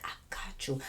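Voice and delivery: low, soft whisper; whispered assurance. Retold or imitated. Answering the officer's hint, low whisper. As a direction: whisper it like a secret deal.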